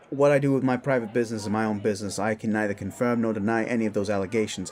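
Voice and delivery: very fast, level rattle; defensive non-denial. Acted. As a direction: rattle it off like a rehearsed disclaimer.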